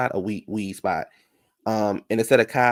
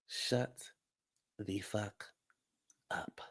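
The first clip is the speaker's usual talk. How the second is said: hushed; 2 semitones lower, 18 dB quieter, much slower than usual. Real, not acted. Hushed dismissal of Kyle.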